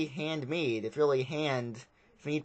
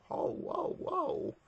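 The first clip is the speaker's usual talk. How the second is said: whispered then squeaky high whoa; mock the creature's moan, airy; hammed-up breathy moan; 4 dB quieter, much slower than usual. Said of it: "Like, um".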